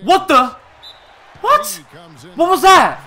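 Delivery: squeaking very high, loud what; shocked disbelief. Real, not acted. Gamer stunned by a crazy play, shrieking disbelief.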